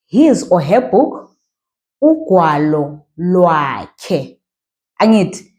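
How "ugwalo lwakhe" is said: "unyawo lwakhe" stretched out slowly; teacher drawing out the phrase; slow demo. Shown as a demonstration. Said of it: "slow down sometimes".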